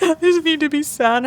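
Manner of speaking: high, small mock-crying voice; imitated tearful child. Mom imitating her crying daughter, high tearful child voice. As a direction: plead like a weepy little girl. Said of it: "when she's crying".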